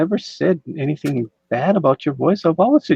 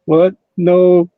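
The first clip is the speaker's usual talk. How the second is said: startled, louder denial; surprised; 5 semitones higher, 10 dB louder than usual. Real, not acted. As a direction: blurt it out in real surprise. Surprised at dog licking monitor.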